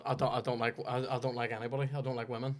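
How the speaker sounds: a hushed hint of a TV presenter; Jeremy Clarkson. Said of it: "there's my Jeremy Clarkson impression".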